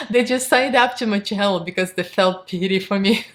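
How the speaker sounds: usual speaking voice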